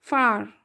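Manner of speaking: "फार" with a very light final R; "फार" as a long faa; correct pronunciation. Shown as a demonstration. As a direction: drop most of the R from "फार". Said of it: "फार नहीं आएगा".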